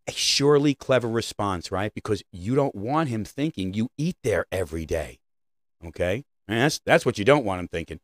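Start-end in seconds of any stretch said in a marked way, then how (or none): none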